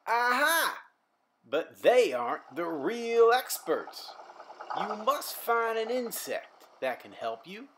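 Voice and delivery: a jolly farmer giving advice. Acted. The farmer explaining pollination to Simon, hearty. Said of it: "responded the farmer".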